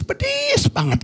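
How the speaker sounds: high, squeezed retold complaint; stinging complaint. Preacher imitates wife hurt by harsh words, stinging complaint.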